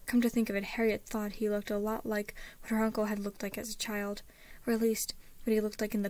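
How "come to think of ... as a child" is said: ordinary delivery, nothing marked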